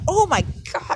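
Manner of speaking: high, loud "Oh my God" of realization; excited gasp. Retold or imitated. Friends realizing their matchmaking was pointless, loud and high. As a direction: squeal it as the penny drops. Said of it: "and then them all be like".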